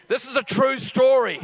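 ordinary talk

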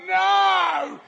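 long, high, loud mock cry of disbelief; mock horrified yell. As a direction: yell it out in hammed-up dismay. Retold horror at the skit.

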